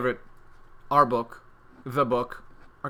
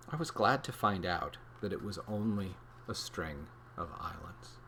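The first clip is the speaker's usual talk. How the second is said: soft, lower storybook narration; reading to children; 5 semitones lower, 5 dB quieter than usual. Acted. Host reading dinosaur passage to kids, soft storybook voice. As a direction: tell it like a quiet bedtime story.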